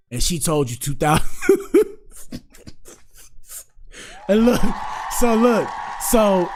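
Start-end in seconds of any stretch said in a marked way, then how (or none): 1.3-1.9 high-pitched sudden laugh; burst of laughter. Real, not acted. Host reacting to the two thousand count, high burst of laughter. Laughs at the number just retold. 4.3-6.5 amused, barely composed. Real, not acted. Host trying to resume after the punchline, amused and high-pitched.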